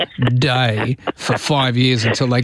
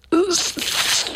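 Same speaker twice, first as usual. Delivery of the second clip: much higher, strained stuck apology; mock the Fonz who can't say sorry; Fonz struggling to apologise; 4 dB quieter than usual. Host failing to apologise to Justin, strained and much higher. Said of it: "you actually sound like a good Albanese impersonator".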